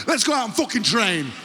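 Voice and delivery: high, forceful coach's shout; rally the squad harshly; mock rallying yell. Coach sending players to train, rallying yell.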